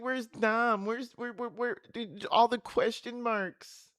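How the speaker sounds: high-pitched, stammering mimicry; hammed-up helpless whine, drawn out at the end; mock bewildered. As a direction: ridicule someone lost in questions. Mockingly imitates the woman's questions.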